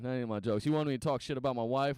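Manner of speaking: normal talking voice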